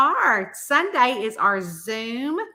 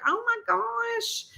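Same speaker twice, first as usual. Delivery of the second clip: mock gasp; 13 semitones higher, slower than usual. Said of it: "So I'm like".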